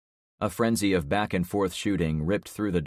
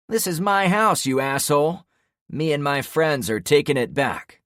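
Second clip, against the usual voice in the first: much higher, loud angry yell; furious shout; 9 semitones higher, 6 dB louder than usual. Acted. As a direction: scream your claim to the house. Trevor claiming his house.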